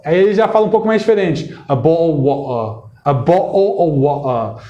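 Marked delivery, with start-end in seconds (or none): 1.7-4.6 posh traditional British accent, slow. Shown as a demonstration. Teacher showing a traditional British accent, slow. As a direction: drop the t's the old British way. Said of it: "um britânico, talvez, tradicional".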